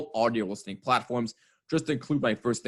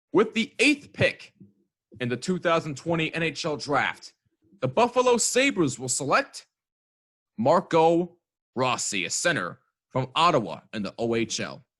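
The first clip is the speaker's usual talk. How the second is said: draft announcer; 5 semitones higher, 3 dB louder than usual. Acted. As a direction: announce the pick like the commissioner. Mock draft pick announcement.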